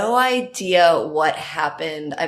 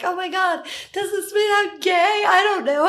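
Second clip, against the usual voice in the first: much higher, sing-song hammed-up freakout; mock panic; 12 semitones higher than usual. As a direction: squeal it like a drama queen.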